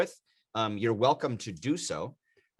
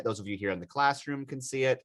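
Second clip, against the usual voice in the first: ordinary delivery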